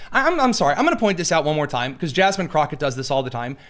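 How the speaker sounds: ordinary talk, nothing marked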